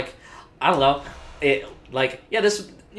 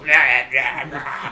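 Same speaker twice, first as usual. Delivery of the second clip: bad impression; 4 dB louder, much slower than usual. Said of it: "when you do go".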